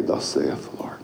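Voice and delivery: hushed, breathless whisper. Acted. Pastor to the church, whispering the charge. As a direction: drop it to a hush. Whispered after loud preaching.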